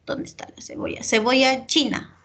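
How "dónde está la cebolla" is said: muttering to herself. Real, not acted. Teacher searching the student's text.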